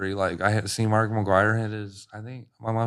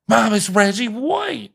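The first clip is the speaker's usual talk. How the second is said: much higher, slowed, amazed; kid's excited recognition; 11 semitones higher, 3 dB louder, slower than usual. Retold or imitated. Boy telling mom the star is right there, high and amazed. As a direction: point and gasp out the name.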